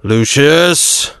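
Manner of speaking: sharp call across the room; calling yell. Acted. Governor calls his servant, a mild yell. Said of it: "then yelled".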